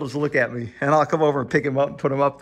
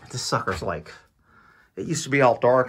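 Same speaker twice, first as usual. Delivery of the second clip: ordinary delivery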